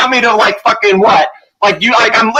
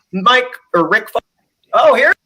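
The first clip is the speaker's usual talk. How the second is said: usual speaking voice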